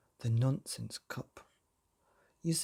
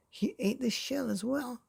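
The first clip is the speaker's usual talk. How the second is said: much higher, odd gleeful voice; giggly storyteller reveal; delighted reveal; 9 semitones higher than usual. A narrator's reading. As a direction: squeak out the silly punchline. Narrator delighting in absurd detail.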